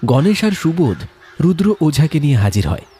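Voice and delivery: usual speaking voice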